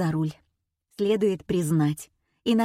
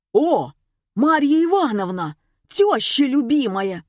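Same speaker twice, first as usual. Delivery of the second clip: gleeful guess; 6 semitones higher, 4 dB louder than usual. A narrator's reading. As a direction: blurt it with mock delight. Caller blurts triumphant mocking guess. (радостная догадка с издёвкой)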